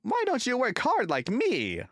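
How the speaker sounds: high, loud smug brag; little engine bragging high and loud; cheeky boast. A narrator's reading. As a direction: show off to the big engine. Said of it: "He would then laugh rudely".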